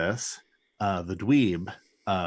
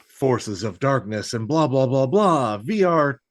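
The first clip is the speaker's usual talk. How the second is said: higher, louder hammed-up evil chant; hammed-up supervillain invocation; mock villain incantation; 6 semitones higher, 4 dB louder than usual. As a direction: boom it like a cartoon villain summoning. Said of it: "he goes".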